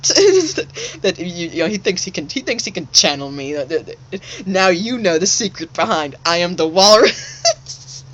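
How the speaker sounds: high and loud, breaking into laughter; laughing while passing on a message; laughing quote. Real, not acted. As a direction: giggle through the quoted words. Laughing while quoting [name].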